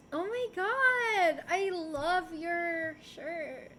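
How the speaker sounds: slow, drawn-out, backhanded compliment; fake nice. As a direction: imitate a mean friend being sweet, very slowly. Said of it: "they're, like, nice mean".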